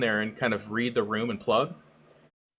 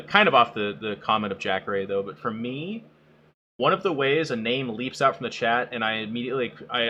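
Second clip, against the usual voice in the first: ordinary talk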